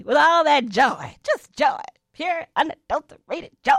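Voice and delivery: giddy overflowing joy; gushing joy. Real, not acted. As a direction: pour out pure delight. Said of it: "what is going on with her".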